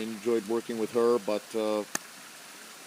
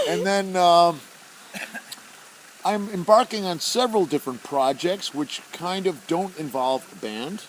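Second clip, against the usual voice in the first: ordinary talk, nothing marked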